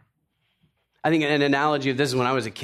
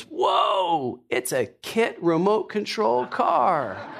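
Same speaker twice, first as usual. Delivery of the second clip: high, drawn-out whoa of amazement; thrilled dad, voice way up; excited exclamation; 8 semitones higher, much slower than usual. Retold or imitated. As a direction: crow with delight over a present, slowly. Father opens his present, exclaiming.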